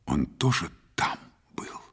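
hushed, breath-only question; secretive whisper. A narrator's reading. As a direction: ask it in a cautious whisper. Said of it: "спросила шёпотом".

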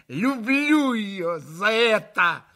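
slow, loud, twisted with rage; distorted voice. A narrator's reading. Katya tells Alyosha of Grushenka, voice twisted with rage. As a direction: force it out through fury. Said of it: "искажённым голосом прибавила Катя".

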